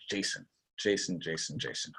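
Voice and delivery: very soft, low repeating to oneself; acted quiet pondering, trying to place a name; puzzled muttering. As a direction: mull the name over under your voice. Acting customer puzzling over a name.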